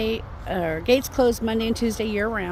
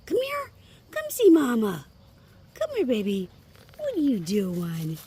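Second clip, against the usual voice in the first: talking to a dog; 9 semitones higher, 4 dB louder than usual. Real, not acted. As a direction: coo up high to the pups. Calling and cooing to the dogs.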